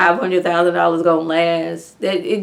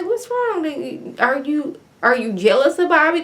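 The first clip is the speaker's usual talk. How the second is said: gentle concern; 8 semitones higher than usual. Retold or imitated. Gently asking Michael.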